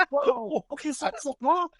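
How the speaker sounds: ordinary talk, nothing marked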